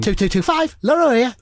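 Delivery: high phone voice; Mark Lawrenson. Acted. Mark picks up the phone, in high-pitched phone voice. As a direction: recite the number in a put-on telephone voice. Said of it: "Is that his phone voice?".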